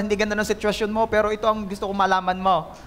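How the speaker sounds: normal talking voice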